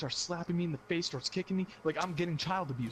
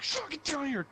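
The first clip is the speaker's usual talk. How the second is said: higher mock angry mom; mom yelling upstairs; 12 semitones higher than usual. Mom calling son back downstairs, high mock anger. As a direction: call him back down like a mad mother.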